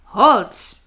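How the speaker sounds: loud bellowed halt; deafening bellow. Acted. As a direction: bellow it to stop them dead. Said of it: "bellowed a deafening".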